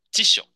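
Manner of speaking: 几九 said loud, with the weight on 几; stress on first syllable. Shown as a demonstration. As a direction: hit 几 hard, then let 九 fall away. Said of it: "前面第一个音要重音哦".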